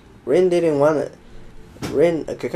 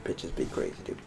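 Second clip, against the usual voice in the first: whispered; 7 semitones lower, 13 dB quieter than usual. Pretend. Repeating a catchphrase, whispered.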